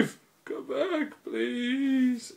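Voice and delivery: slow, very high mock wail; drawn-out falsetto plea; pleading cadet. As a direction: whine plaintively for him to return. Acts cadet pleading after Chief.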